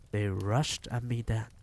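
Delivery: usual speaking voice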